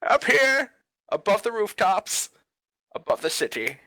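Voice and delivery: put-on a grand old weatherman announcing himself; lightly voiced old man, dramatic intro. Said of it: "I'll do the weatherman".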